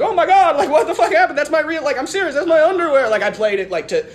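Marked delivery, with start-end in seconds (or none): 0.0-3.1 hammed-up shock. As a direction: overplay the horror for the crowd. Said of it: "played it up and I was like".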